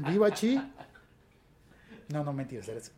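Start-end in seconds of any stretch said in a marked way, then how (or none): 0.0-0.6 high, loud cheer-leading call; grito de arenga. Acted. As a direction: lead the chant, loud and high. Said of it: "eso es cuando le dicen". (arenga fuerte y aguda)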